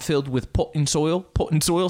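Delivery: British accent. Shown as a demonstration. Host proves he can't play British, hammed-up British accent. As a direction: do a shaky attempt at a British dude. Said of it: "never gonna play a British dude".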